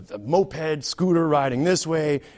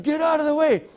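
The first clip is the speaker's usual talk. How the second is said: irritated cyclist; 9 semitones higher than usual. Retold or imitated. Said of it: "and he's like".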